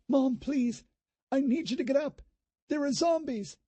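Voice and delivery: put-on high girl's voice, urgent and scared; a daughter hurries her mom away; frightened young girl. A narrator's reading. Daughter urging her mother to flee the zombies, high and scared.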